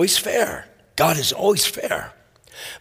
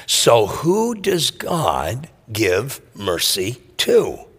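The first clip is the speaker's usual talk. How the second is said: ordinary talk, nothing marked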